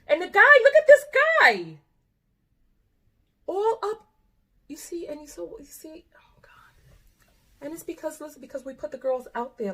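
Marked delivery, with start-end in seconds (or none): 0.1-4.1 high, loud disbelieving exclamation; shocked outburst. Real, not acted. Host spotting the grown man in the clip, shocked outburst. 4.7-7.6 hushed disbelieving whisper; stunned whisper. Real, not acted. Host watching the dance clip in disbelief, whispering.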